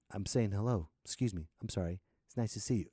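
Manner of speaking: low, soft, gentle greeting; mild-mannered and apologetic, kept small; polite nice stranger. Acted. Polite Canadian to suspicious American.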